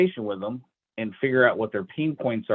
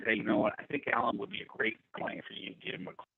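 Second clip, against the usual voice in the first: quiet quoted aside; 3 semitones lower, 9 dB quieter than usual. Retold or imitated. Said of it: "Cheryl said".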